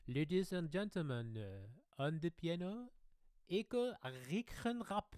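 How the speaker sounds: put-on high, drawn-out French-accented introduction; French MC building to the name; French announcer. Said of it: "in France, when I perform".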